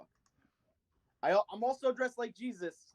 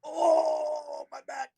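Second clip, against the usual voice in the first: higher, hammed-up old man's groan; mock pain from a bad back; hurt old man; 7 semitones higher, 4 dB louder, much slower than usual. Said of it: "pretend that he hurt my back real bad because I'm old".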